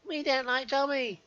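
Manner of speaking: high, slow taunting voice; sing-song a playground taunt; mocking troll voice. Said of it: "are just basically saying".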